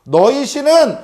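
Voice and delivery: mocking taunt. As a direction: taunt them from a high pitch, stretching the words. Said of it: "하고 비웃으면서 이야기했던 거예요". (높은 목소리로 비웃듯이)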